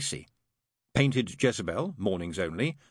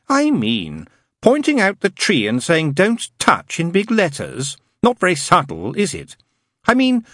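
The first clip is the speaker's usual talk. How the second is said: lightly voiced wry demon, pitched higher; a demon poking fun, wry; knowing demon; 9 semitones higher, 9 dB louder than usual. Demon mocking God's garden to the angel, wry.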